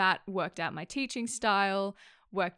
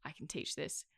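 quiet aside to self; 12 dB quieter than usual. Retold or imitated. Retold private reaction to curriculum.

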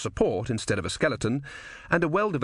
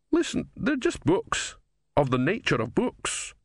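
put-on insistent old philosopher; 8 semitones higher than usual. Philosopher insisting to confused Brother.

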